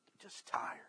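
faint, spent whisper; tearful whisper. Retold or imitated. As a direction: barely breathe the words out, worn out. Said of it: "He goes".

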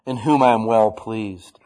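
slow, loud, booming declaration; a father's voice booming out from heaven. Retold or imitated. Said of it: "out loud goes".

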